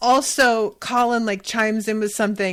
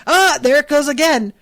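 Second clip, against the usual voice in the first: louder, higher mock cry of delight; teasing exclamation; 7 semitones higher, 10 dB louder than usual. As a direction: call it out like a carnival ride operator. Colin jiggling bat basket again.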